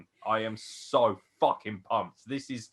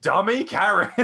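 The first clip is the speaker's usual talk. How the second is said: taunting heel; 11 semitones higher, 10 dB louder, much slower than usual. Retold or imitated. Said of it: "he's just like".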